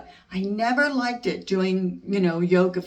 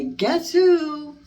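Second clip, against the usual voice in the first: playful; 10 semitones higher, 6 dB louder than usual. Acted. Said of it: "Put on disguises and say".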